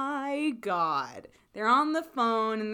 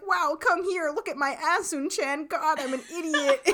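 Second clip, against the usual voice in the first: higher, hammed-up bragging show-off; goofy show-off voice; 7 semitones higher than usual. Guy showing off his tattoo to Eun-chan, goofy hammed-up voice. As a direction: ham up the cocky invitation, pitched high. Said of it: "saying all that basically in that voice".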